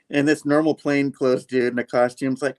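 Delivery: usual speaking voice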